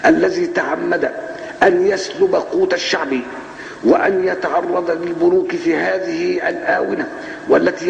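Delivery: high, slow, bombastic courtroom oratory; mock prosecutor speech. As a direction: ham up a pompous prosecutor's speech. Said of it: "يقول إيه بقى؟".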